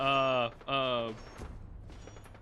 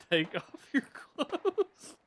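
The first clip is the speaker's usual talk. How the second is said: high-pitched, drawn-out mock spooky voice; mock whispering door; 11 semitones higher, much slower than usual. Hidden assassin teasing someone through the door, mock whispering. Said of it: "like, whispering through the door".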